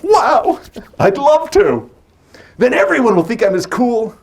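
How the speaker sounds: put-on high, loud, eager kid voice; wide-eyed boy can't wait; excited child. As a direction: gush like a thrilled nine-year-old. Child self's eager thought.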